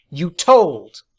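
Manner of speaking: loud accusing shout. A narrator's reading. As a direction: yell it at him. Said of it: "I shouted at him".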